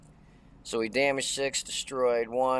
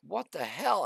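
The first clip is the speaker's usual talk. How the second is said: higher, baffled what the hell; stunned disbelief; 7 semitones higher than usual. Retold or imitated. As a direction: react like the shot made no sense. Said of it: "I'm like".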